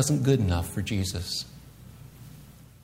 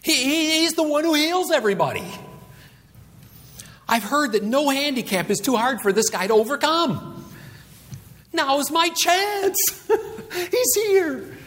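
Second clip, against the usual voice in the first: very high, breathless-with-hope exclaiming; stammer, then build to a high squeal; excited blind man; 12 semitones higher than usual. Acted. Blind man sees his only chance, excited. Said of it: "What do you think's going through the mind of this blind man".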